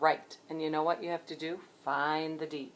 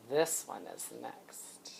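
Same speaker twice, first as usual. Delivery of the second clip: whisper; 8 semitones lower, 10 dB quieter, slower than usual. Acted. Secretive reveal of next letter.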